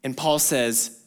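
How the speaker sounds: usual speaking voice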